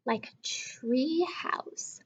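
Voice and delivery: slow hushed whisper; secretive slow whisper. Acted. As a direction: let it out like a secret. Reading dad's surprise idea.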